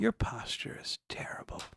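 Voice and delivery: voiceless creepy whisper. Acted. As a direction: hiss it in a menacing whisper. Aunt's creepy whispered remark.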